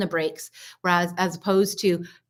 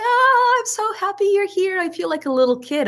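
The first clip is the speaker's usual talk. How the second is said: put-on very high, gushing little-kid squeal of welcome; high-pitched kid voice; 13 semitones higher, 4 dB louder than usual. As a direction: start loud and high, easing down slightly. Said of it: "that really high-pitched voice".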